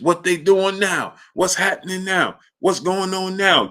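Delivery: mock confused old fan; imitating blind uncle. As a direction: ask over and over like the old uncle.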